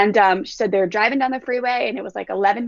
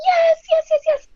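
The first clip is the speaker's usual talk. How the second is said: put-on high squealing yes yes from excited boys; excited kids; 6 dB louder than usual. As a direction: squeal it like thrilled kids, much higher and louder. Boys excited about Legoland.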